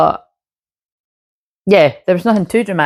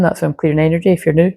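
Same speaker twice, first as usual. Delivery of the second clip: ordinary delivery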